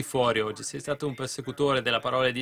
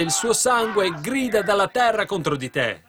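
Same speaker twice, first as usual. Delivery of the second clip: raised, high preaching cry; ringing accusation; 7 semitones higher, 5 dB louder than usual. Acted. Preacher voicing God's charge against Cain, ringing accusation. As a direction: hurl the accusation out from the pulpit.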